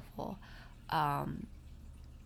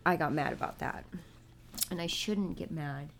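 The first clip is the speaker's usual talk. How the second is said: ordinary delivery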